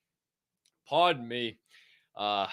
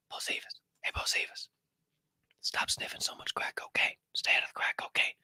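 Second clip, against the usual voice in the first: a teasing stage whisper, leaning in close; joking whisper; 8 dB quieter than usual. Pretend. Teasing a viewer named Bocephus about crack, in a stage whisper. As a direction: whisper "Bocephus" and the crack cocaine warning, breathy and unvoiced.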